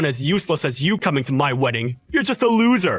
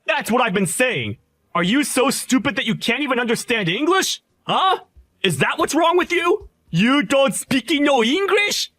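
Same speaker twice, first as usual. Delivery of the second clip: furious shout; 11 semitones higher than usual. Acted. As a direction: scream him out of your wedding. Groom screaming him out of wedding.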